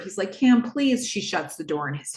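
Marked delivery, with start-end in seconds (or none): 0.3-1.1 desperate plea. Retold or imitated. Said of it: "Boz is so desperate".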